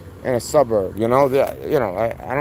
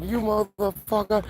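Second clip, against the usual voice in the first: high-pitched retold insult, softer than real rage; flipping out; 7 semitones higher, 5 dB quieter than usual. Road-rage driver cursing the narrator, retold flipping out. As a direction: mimic an enraged driver without full volume. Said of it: "He starts flipping the fuck out".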